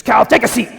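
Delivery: loud, high hammed-up Scottish accent that slides into pirate; mock Scottish accent. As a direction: bark it like a Scottish boss who sounds like a pirate. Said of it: "that sounds like a pirate accent, not a Scottish accent".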